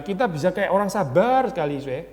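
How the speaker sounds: ordinary delivery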